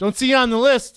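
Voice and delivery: high, loud announcement; doorman's rejection. Retold or imitated. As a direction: turn someone away in front of friends. Retold club doorman rejecting him.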